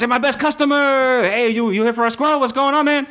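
high, eager hustler voice; squirrel dealer. Acted. Tracksuit dealer greeting the unicorn in a high hustler voice. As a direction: greet him like a sleazy pal. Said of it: "And he goes".

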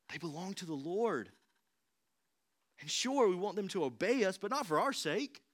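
normal talking voice

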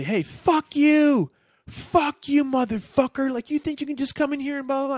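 hammed-up angry receptionist telling a guy off; imitated high, loud cursing tirade; furious outburst. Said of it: "She used to just freak out and say".

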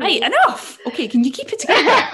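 loud, laughing telling-off; mock scolding. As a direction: play the stern host, half laughing.

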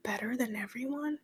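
mock smug whisper. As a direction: breathe it out smugly like the meme. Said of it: "this sound from TikTok that goes".

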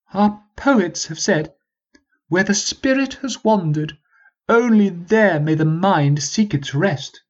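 high, slow pompous recitation; lofty noble quoting poets; posh prince voice. Acted. Peabody's speech, posh voice.

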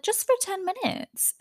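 imitated coaxing in a very high voice; Garcelle. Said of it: "speaking so soft and so calm".

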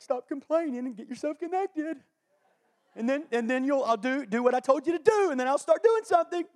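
a mock stern God telling them off; voicing God. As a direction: scold the complainer as God. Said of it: "God's like".

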